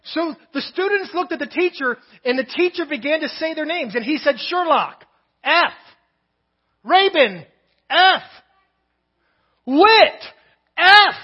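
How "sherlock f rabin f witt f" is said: "F" rings out louder and higher each time; calling out grades. Retold or imitated. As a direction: read the names, each "F" like a verdict. Professor reads out failing grades.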